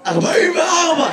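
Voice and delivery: real hoarse shouted answer. Real, not acted. Audience member answering the comedian's age question, hoarse. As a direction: call it out through a hoarse throat. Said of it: "למה אתה נשמע ככה".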